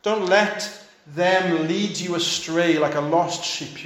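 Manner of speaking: usual speaking voice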